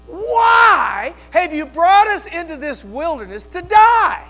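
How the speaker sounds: high, loud, desperate cries at Moses; rising to a full shout on leave us alone; panicked people yelling. Acted. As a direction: wail it out as a terrified crowd blaming their leader. Preacher voicing panicked Israelites.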